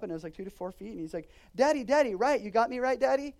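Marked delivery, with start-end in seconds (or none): 1.5-3.3 put-on louder, higher pleading kid voice; a small boy begging his daddy for reassurance; anxious little boy. Son calling to his daddy while paddling out, a louder, higher anxious plea.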